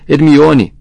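loud, high roar of a name; anguished roar. A narrator's reading. Rony calling to Hermione upstairs, anguished roar. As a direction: roar it out at full force. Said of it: "urrou Rony".

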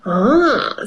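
plain reading voice

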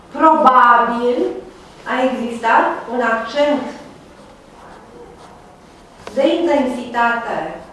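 very slow, spaced-out lecturing; slow dictation. Real, not acted. Lecturer giving students a conclusion to note, slow dictation. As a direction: dictate it phrase by phrase, slowly.

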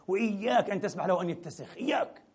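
ordinary delivery

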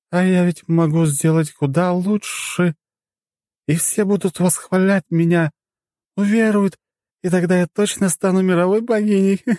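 put-on dream big, drunk and pleased; scheming drunk goddess.

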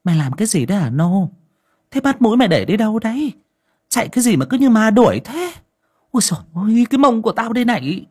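high, fast grumbling granny voice; old mistress scolding the servant who knocked her down; scolding old woman. Acted. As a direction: tell him off while rubbing a sore backside. Old lady scolds fleeing servant.

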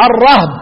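الرهب as ar-rahb; single-syllable "rahb" in الرهب; fatha then sukun. Shown as a demonstration. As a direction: say الرهب with a on ر and silent ه. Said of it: "وبعدين اقول ايه؟".

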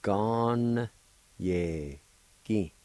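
long held first syllable, each one separate; syllable-by-syllable pronunciation. Shown as a demonstration. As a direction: sound out the word in drawn-out pieces. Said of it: "pronounce the N sound with the G A like this".

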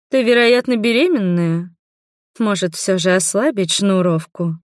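usual speaking voice

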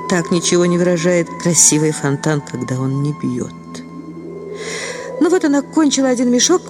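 ordinary delivery, nothing marked

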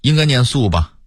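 ordinary talk

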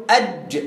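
"أج" with jeem stopped dead after a short a; wrong vowelless jeem. Shown as a demonstration. Student giving the wrong answer, "أج" with jeem unvowelled. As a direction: close "أج" on a vowelless j. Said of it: "غلط".

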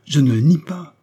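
irritated shout. A narrator's reading. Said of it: "cria une autre voix irritée". (cri irrité, plus aigu et plus fort)

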